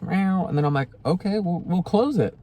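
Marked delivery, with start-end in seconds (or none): 0.0-0.5 cat meow. Retold or imitated. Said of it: "And she's like". 1.1-2.4 talking to the cat. Real, not acted. Promising her cat to close doors.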